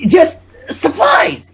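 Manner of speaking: high, slow, drawn out; nervous cover-up. Acted. Trixie covering up to Twilight, high and slow. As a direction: stretch it out high and fake-casual.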